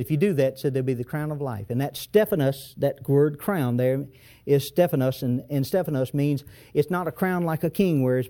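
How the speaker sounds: ordinary delivery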